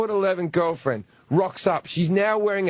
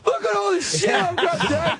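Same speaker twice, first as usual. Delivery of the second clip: high laughing showing-off voice; excited son; 13 semitones higher than usual. Retold or imitated. Son showing Dad his loot, high laughing brag. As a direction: brag like a giddy young guy. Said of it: "And he's like".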